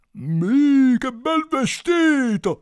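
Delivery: high, loud, stretched simpleton voice; hammed-up wide-eyed fool; foolish first son. A narrator's reading. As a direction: gush loudly like a silly son. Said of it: "disse il primo figlio".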